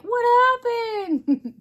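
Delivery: high, drawn-out wail of dismay; exasperated cry. Retold or imitated. Spinner crying out at her clumping wheel, high dismayed wail. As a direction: cry out in mock despair at the wheel. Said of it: "And I'm like".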